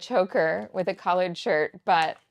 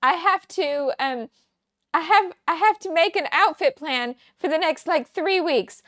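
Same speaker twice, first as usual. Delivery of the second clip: higher, louder mock panic; mock dramatic; 8 semitones higher, 7 dB louder than usual. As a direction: play it as a joking crisis, higher and louder. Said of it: "and I said".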